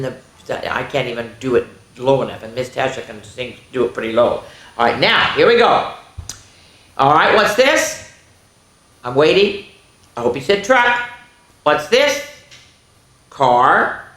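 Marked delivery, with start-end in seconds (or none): none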